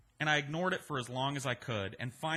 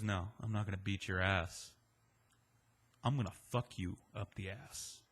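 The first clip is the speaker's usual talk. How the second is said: put-on creepy menacing drunk; 6 semitones lower, 4 dB quieter than usual. Waylon's creepy threat.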